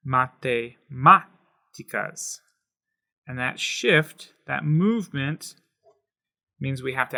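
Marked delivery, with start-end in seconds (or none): 0.1-2.4 "Matemáticas" very slow and louder, with the stress on MÁ; accented syllable. Shown as a demonstration. Teacher proudly modeling the accented "Matemáticas". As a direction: slowly lean into the MÁ of "Matemáticas". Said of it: "move it over one place and say".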